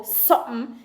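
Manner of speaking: "something" said as sumn, two syllables with no th; "something" clipped to sumn; patois something. Shown as a demonstration. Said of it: "we spell it, um, S U M O".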